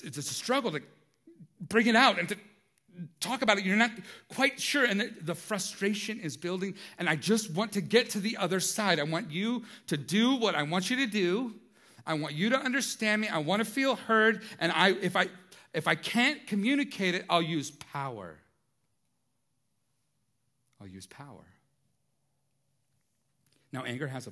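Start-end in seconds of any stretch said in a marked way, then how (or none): 0.0-18.4 louder, higher, intense outburst; frustration building loudly. Acted. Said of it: "You get loud. You get intense". 20.8-21.5 low and much softer after the outburst; quiet, grave repeat. Acted.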